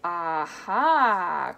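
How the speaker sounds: loud, drawn-out exclamation; big swooping outburst; triumphant cry. A narrator's reading. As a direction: crow it with relish. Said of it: "cried Mister Wonka".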